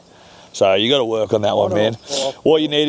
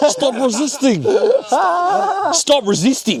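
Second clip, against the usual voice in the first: higher Aussie cop voice; Aussie accent. Retold or imitated. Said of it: "So you do Aussie accent".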